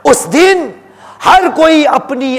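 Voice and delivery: loud, high proclamation; forceful climactic cry; impassioned preaching. Acted. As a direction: thunder the warning from the pulpit. Climactic warning of judgment day.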